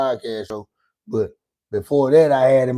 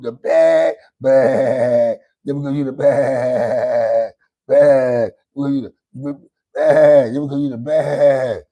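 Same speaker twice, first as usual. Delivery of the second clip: hammed-up fake sob while taunting; mock crying; 7 dB louder, slower than usual. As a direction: whine it like a fake cry.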